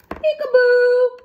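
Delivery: high, loud peekaboo; spring the surprise loudly; high loud playful peekaboo; peekaboo reveal. Acted.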